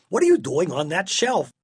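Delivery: astonished parent's question; baffled questioning. A narrator's reading. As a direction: ask in sheer disbelief. Father baffled at finding her.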